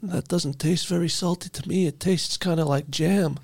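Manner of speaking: a pompous crime boss gloating to his crew; Tooth Fairy mob boss. Acted.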